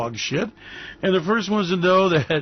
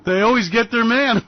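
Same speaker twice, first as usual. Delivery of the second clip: louder and higher, taunting; loud gloating warning; 5 semitones higher, 5 dB louder than usual. Acted. Host taunting Trump that they always get their man, loud gloat. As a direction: gloat it out like a threat.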